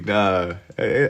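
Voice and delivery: ordinary delivery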